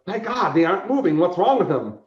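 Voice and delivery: high, loud stage bewilderment; acted alarm. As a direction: exclaim it like an alarmed show host.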